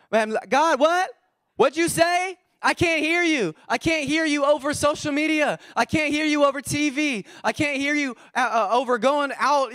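high-pitched calling out, as if over noise; acted can't-hear-you. Believer calling to God over life's distractions, high acted shout. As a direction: strain to be heard over a din.

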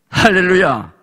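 high, loud hallelujah shout; hallelujah call. Real, not acted. Preacher shouting hallelujah to rouse the church. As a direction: rouse the congregation.

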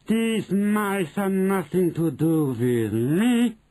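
high, slow, emphatic witch shout; shouted denial. A narrator's reading. Witch disowning the mice, high slow shout. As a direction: draw out each word, pitched high. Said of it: "she shouted".

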